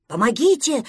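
loud cry for help; shouting. A narrator's reading. Prostodursen cries out from pretend boat, shouting. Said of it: "снова закричал Простодурсон".

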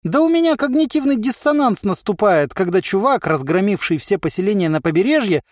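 high, agitated merchant; exasperated high-pitched complaint; baffled explanation. A narrator's reading. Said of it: "пояснил ему Харчик".

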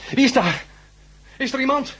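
high, loud anxious calls; calling out anxiously. Acted. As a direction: call into the dark, unsure who's there. Frightened patient calls out.

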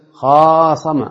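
خاصم with a long held aa after خ; stretched long vowel. Shown as a demonstration. Teacher answering with an example, خاصم with its first vowel held long. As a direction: draw out the alif in خاصم.

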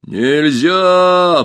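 loud, booming creature refusal; monster roar. A narrator's reading. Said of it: "проревело чудовище".

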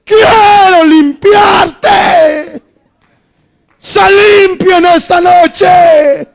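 loud, high, slow crying proclamation; Jesus calling out loud and slow to heal the leper; voice of Jesus proclaiming. Acted. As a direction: ring it out like a voice from heaven. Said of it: "se oyó una voz que decía".

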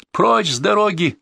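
high, drawn-out cry; shouting. A narrator's reading. Cornered Renard orders pursuers away, crying out. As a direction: order them out of the way. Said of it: "продолжал кричать Ренард".

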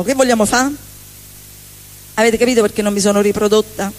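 usual speaking voice

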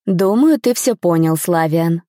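ordinary delivery, nothing marked

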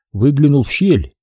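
ordinary delivery

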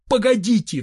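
high urgent call; crying out. A narrator's reading. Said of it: "вскричал я". (высокий оклик вдогонку)

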